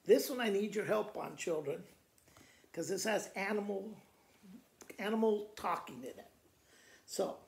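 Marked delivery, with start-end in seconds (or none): none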